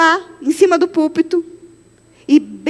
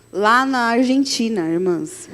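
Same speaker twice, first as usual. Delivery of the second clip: usual speaking voice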